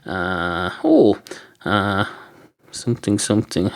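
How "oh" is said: a sharp, high, loud oh of real surprise; sudden surprise. Real, not acted. Reading a surprising text from his wife, sudden loud oh. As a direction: caught off guard by a text, blurt it out. Reacts to wife's text mid-sentence.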